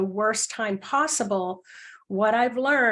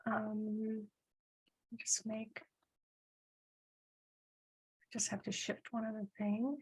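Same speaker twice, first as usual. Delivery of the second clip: very soft, absent aside; talking to oneself; 13 dB quieter than usual. Real, not acted. Host to herself while shifting something mid-event, quiet aside. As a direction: murmur it under your breath, much softer.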